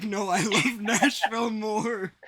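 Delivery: loud, high, drawn-out mock protest; exaggerated, high-pitched imitation of someone insisting; mock quoted declaration. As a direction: belt it out slowly, hamming up the quote. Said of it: "He's like".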